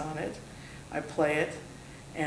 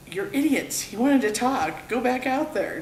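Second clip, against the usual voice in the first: scolding; 8 semitones higher, 6 dB louder than usual. Retold or imitated. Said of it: "Dave Schrader said".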